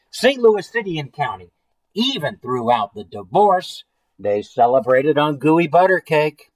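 emphatic, deliberate read-aloud; story narration. A narrator's reading. Reading of city and county celebrating on cake, story narration. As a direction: read it as a grand tall tale. Reads a picture book aloud.

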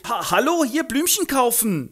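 sehr hoch, laut, prollig; Straßengöre. Acted. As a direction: patzige Göre im Jugendslang. Eliza bettelt. (hohe, laute Prollstimme)

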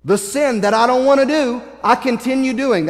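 worked-up confession of a losing fight; frustrated apostle. Retold or imitated. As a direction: preach out Paul's frustration. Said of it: "I can see Paul getting so frustrated".

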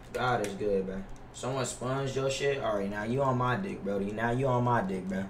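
normal talking voice

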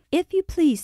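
high-pitched maid's announcement; polite high call at the door; announcing. A narrator's reading. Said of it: "announced Martha".

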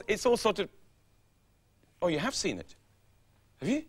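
ordinary talk, nothing marked

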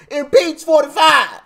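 loud, high mock shout of the slogan; imitating Maxine Waters. Vlogger mocks Maxine Waters's voice, shouting impeach forty-five. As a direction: ham up her rally chant. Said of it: "I hate this lady's voice".

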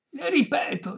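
high, emphatic restatement; exasperated. A narrator's reading. Violinist repeating himself to deaf lady, exasperated high voice. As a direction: say it high, patience gone.

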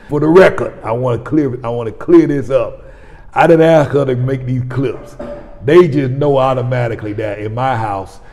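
loud, fired-up preacher voice; preaching. Real, not acted. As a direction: preach it with full force. Fired-up preaching about content.

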